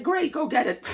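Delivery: a lightly voiced booming God, encouraging; God cheerfully telling him to go for it; God's voice. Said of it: "He said".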